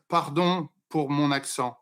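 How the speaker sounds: "Pardon pour mon accent" said as French; "Pardon pour mon accent" in spoken French; French pronunciation. Shown as a demonstration. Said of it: "In, in French is".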